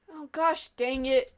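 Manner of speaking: ordinary talk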